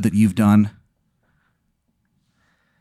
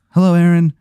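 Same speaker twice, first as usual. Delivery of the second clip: dummy's greeting; 6 semitones higher, 5 dB louder, faster than usual. Acted. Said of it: "And he goes".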